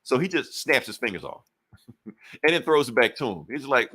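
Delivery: ordinary delivery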